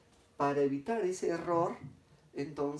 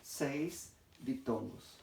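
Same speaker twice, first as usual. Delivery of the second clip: seis diptongos, drawn out and softer; slow emphasis; 2 semitones lower, 5 dB quieter, much slower than usual. Shown as a demonstration. Teacher stressing the count of diptongos to the class, slow. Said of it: "Acá habrían".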